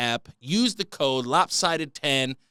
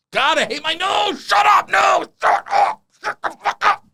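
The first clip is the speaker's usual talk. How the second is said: screaming much higher and louder; furious shout; 9 dB louder than usual. Real, not acted. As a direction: yell at your own nose to shut up. Yelling at his whistling nose.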